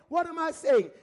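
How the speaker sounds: ordinary talk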